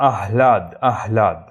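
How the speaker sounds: আহ্লাদ said loud as "ah-laad"; correct pronunciation. Shown as a demonstration. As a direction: breathe out a clear h before the l of আহ্লাদ. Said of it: "সঠিক উচ্চারণটা আহ্লাদই হবে".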